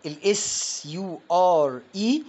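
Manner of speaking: letters S U R E spelled out slowly, each one drawn out; slow letter-by-letter spelling. Shown as a demonstration. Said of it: "بتنطق شور".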